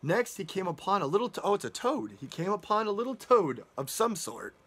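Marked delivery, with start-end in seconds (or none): none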